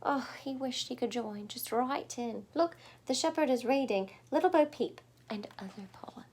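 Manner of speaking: ordinary delivery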